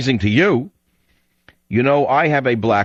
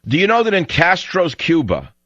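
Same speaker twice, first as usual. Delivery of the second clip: high-pitched, heated rhetorical questioning; indignant challenge; 9 semitones higher than usual. Real, not acted. Radio host challenging listeners about Castro's Cuba, indignant. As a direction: press the listener with worked-up, high-pitched insistence.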